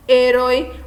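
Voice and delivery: "herói" said as Spanish héroe, HÉ loud; antepenultimate stress. Shown as a demonstration. Teacher giving an example of an esdrújula, "herói" as héroe with loud first syllable. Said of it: "A sílaba mais forte é a antepenúltima".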